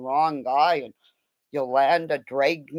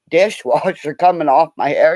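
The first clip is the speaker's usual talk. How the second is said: normal talking voice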